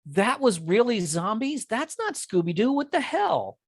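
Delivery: high-pitched, incredulous mock outrage; retold disbelief. Retold disbelief at later shows.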